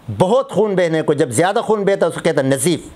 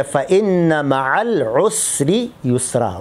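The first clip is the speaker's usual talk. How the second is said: Quranic recitation; 4 semitones lower than usual. Shown as a demonstration. Quran verse recited in lesson.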